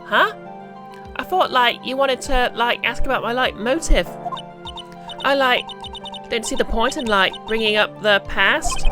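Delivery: put-on high bubbly Valley Girl voice; Valley Girl accent, lots of like. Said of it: "I can't really do a very good Valley Girl accent".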